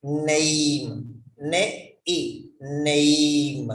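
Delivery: "Name" drawn out, split as "Ne-e", then rejoined; "Name", "Ne-e", "Name" slowly modelled with a long diphthong; long-vowel name. Shown as a demonstration. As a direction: stretch the vowel of "Name" into a glide, syllable by syllable. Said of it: "not name".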